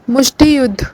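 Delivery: calls the name out loud; loud announcement. Real, not acted. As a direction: announce it loudly to the class. Teacher announces martial art name.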